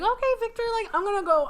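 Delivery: high, airy-casual announcement; acted breezy date talk. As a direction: pitch it high, brushing past the doubt. Sally speaking to her date, acted.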